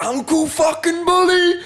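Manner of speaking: high, slow, delighted yell of recognition; over-the-top excited greeting; excited shout. Retold or imitated. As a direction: shout the name out in joy. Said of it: "I was like".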